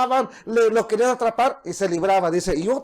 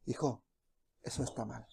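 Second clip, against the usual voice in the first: much softer and lower, hushed; a hushed fatherly whisper; still small voice; 13 semitones lower, 18 dB quieter than usual. Acted. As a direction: breathe it gently like a soft warning.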